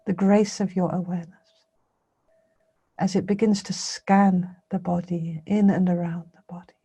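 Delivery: measured meditation guidance with long pauses; guided meditation. Real, not acted. Teacher leading listeners to scan the body with awareness, guided meditation. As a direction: guide them through a body scan, unhurried.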